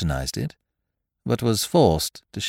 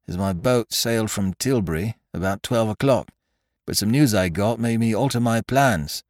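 higher, brisk English officer voice; young soldier; 3 semitones higher than usual. Acted. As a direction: chat obligingly as a young captain.

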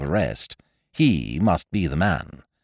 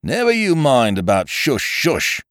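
louder, tart policeman retort; with asperity; 8 semitones higher, 4 dB louder than usual. A narrator's reading. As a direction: snap back at the hushing. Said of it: "said the constable with asperity".

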